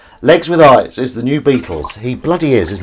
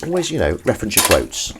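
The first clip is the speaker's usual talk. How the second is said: usual speaking voice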